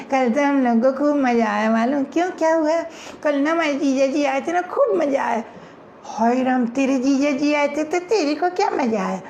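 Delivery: giggly friend sharing news; playful girl gossip; girl chatting. Acted.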